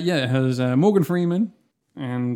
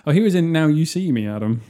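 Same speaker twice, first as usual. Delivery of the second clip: ordinary talk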